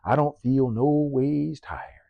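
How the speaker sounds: slow, drawn-out southern preacher imitation; slow mocking southern drawl. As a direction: stretch it out like a southern Baptist minister. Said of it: "speaking in a southern drawl, sounding like a Baptist minister".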